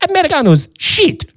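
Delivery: high-pitched mock waiter voice; Italian waiter. Waiter agreeing with the diner, high mock waiter voice. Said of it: "He goes".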